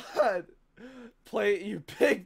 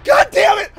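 frustrated yell; 10 semitones higher, 12 dB louder than usual. Real, not acted. Jump scare in horror game.

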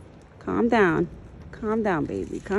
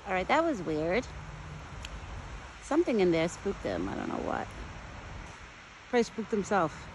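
ordinary talk

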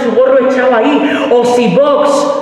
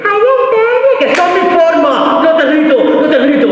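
mocking imitation; 8 semitones higher, slower than usual. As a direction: parody his excuses in a shrieking voice. Mocking the boyfriend's excuse.

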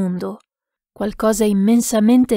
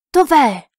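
loud, high call with a falling end; shouting; 5 semitones higher, 6 dB louder than usual. A narrator's reading. Lost man seeking the monk in the dark, shouting. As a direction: call out into the distance.

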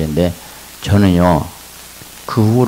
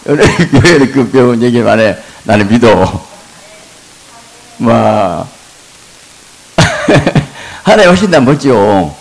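much higher and louder, laughing preacher's cry; full-force preaching; 7 semitones higher, 8 dB louder than usual. Acted. Pastor answering elders' mockery, preaching loud and high. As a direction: preach it out loud over the crowd. Hospital story, excited.

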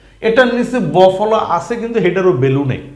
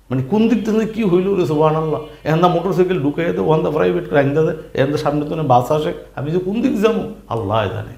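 flustered rush; faster than usual. Retold or imitated. Chaotic Dhaka traffic scene acted.

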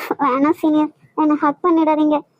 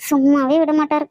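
ordinary talk, nothing marked

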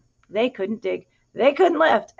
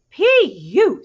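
higher, louder mock disgust; disgusted duck; 6 semitones higher, 8 dB louder than usual. A narrator's reading. Duck mocks the smelly pigs, hammed-up disgust. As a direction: hold your nose and say it. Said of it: "said the duck".

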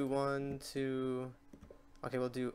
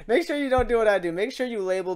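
loud warning; 8 semitones higher, 9 dB louder, faster than usual. Real, not acted. Warning viewers after a mistake.

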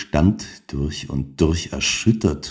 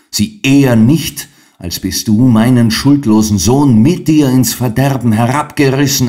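higher, louder outburst of rage; a mother hurling blame at her son's ruin; raised, louder furious accusation; 6 semitones higher, 6 dB louder than usual. A narrator's reading. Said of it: "rief Frau Helena".

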